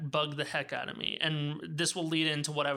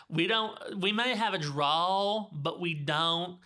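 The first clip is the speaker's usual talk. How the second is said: slow Southern drawl; slower than usual. Shown as a demonstration. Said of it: "put it out like this".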